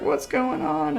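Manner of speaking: high confused heroes. Retold or imitated. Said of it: "they're like".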